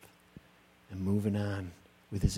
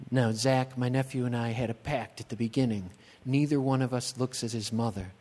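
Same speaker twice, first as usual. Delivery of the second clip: ordinary delivery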